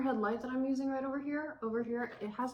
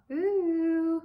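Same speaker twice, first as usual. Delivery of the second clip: delighted ooh; 8 semitones higher, 6 dB louder than usual. Real, not acted. Delighted at tie-dye.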